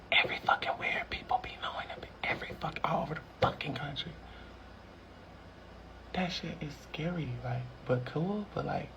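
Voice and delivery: hushed, breathy whisper, words barely voiced; stoned whisper. Real, not acted. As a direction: whisper it like a secret that scares you. High, overwhelmed by being recognised.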